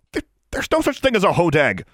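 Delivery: high, stammering scared denial. A narrator's reading. Larry denies the monster, stammering scared. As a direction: stumble over it in fear. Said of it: "Larry stammered".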